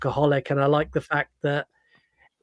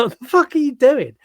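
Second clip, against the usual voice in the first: high, louder disbelieving yelp; incredulous outburst; 9 semitones higher, 4 dB louder than usual. Retold or imitated. Laughing at an anime run in a film, high incredulous yelp. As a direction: blurt it out in shock, much higher. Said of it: "it's like".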